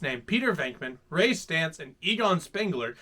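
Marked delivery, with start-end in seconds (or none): none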